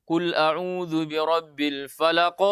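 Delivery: slow recitation with a bigger bounce on "falaq"; big qalqalah. Shown as a demonstration. Teacher showing the wrong big-qalqalah reading. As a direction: let "falaq" bounce out large at the end. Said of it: "dengan sebesar".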